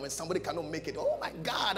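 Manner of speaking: normal talking voice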